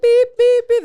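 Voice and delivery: high, loud mock beeping; imitating the security scanner's beep. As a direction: beep like an airport wand. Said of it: "they're like".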